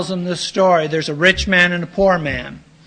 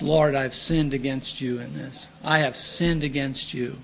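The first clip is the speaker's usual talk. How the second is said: softer, humbled prayer of confession; humble confession; 4 semitones lower, 8 dB quieter than usual. Acted. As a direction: confess it quietly before God. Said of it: "get before God in humility and confess".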